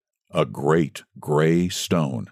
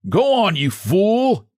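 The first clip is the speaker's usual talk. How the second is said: pudgy man shouting at the little man; angry shout; 12 semitones higher, slower than usual. A narrator's reading. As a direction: bellow at the man below. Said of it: "he shouted".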